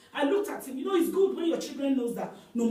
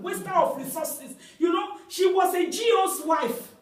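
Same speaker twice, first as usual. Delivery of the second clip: loud, higher preaching shout; fiery preaching shout; shouted condemnation; 7 semitones higher, 5 dB louder than usual. Real, not acted. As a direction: shout it out to the congregation.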